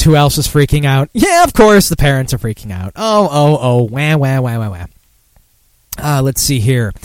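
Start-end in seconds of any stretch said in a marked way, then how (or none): 2.9-4.9 whiny fake baby cry; mocking wah wah crying.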